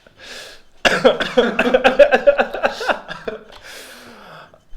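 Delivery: long helpless laugh; long laughter. Real, not acted. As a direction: laugh it out, loud and high. Still cracking up.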